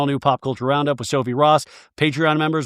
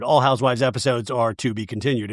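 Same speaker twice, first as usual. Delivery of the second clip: ordinary delivery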